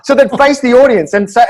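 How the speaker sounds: normal talking voice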